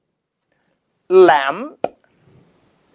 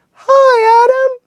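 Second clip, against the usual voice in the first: put-on high child reply; 4 dB louder than usual. Kids' high reply to teacher.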